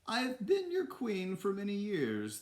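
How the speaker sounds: high, slow falsetto queen; put-on royal address in a very high voice; hammed-up falsetto monarch; toy queen. A narrator's reading. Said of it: "the to-, toy queen went on".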